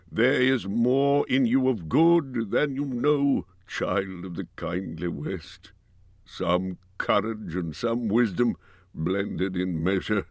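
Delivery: slow, fading words of a dying king; dying dwarf king. Acted. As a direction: bid a last, slow farewell with failing strength. Dying king's farewell.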